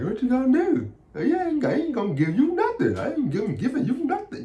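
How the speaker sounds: high, taunting put-on boss voice; petty boss.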